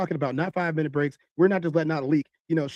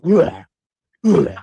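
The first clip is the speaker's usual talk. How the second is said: loud retching groans, imitating her; mock retching; 9 dB louder than usual. Man retelling his date vomiting, loud mock retching. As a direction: heave it out like someone being sick. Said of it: "All I hear is".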